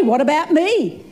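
ordinary delivery

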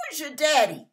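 ordinary delivery

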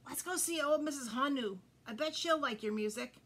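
plain reading voice

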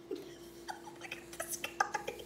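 slow, high whisper with a giggle. Real, not acted. Reader whispers about a drummer animal, amused. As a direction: whisper it like a secret.

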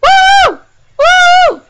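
high, loud whoop; test yell. Shown as a demonstration. Player testing whether a friend hears, test yell. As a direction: yell it to carry next door.

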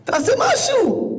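slow, high, pleading cry; imitated desperate plea. Childless woman on the phone begging the rabbi for help, high and pleading. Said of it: "בוכה לי בטלפון".

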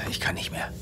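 barely audible, breathless whisper; exhausted whisper. Acted. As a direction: whisper it as if you're at the end of your strength. Vet breaking down alone. (erschöpftes Flüstern)

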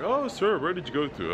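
high-pitched mock customs agent. Customs agent questioning a returning passenger, high mimicking voice. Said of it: "he was like".

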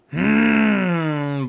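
a frustrated grunt. Real, not acted. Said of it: "sorry for just, uh, grunting".

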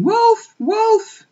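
high, loud bleating cry of wolf; silly sheep crying. A narrator's reading. Empty-headed sheep calling to the flock in panic.

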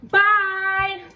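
loud, high, corny sign-off; cheesy goodbye; lame goodbye. Real, not acted. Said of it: "You sound so lame when you said bye".

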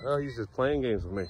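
usual speaking voice

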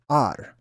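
Ar with no H, opening on the vowel; dropped H. Shown as a demonstration. Teacher shows the other pronunciation, Ar without its H. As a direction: say Ar, leaving off the breathy H. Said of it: "also is pronounced".

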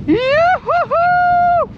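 long high whooping holler; hollering in a forest. Real, not acted. Skiing deep powder, whooping.

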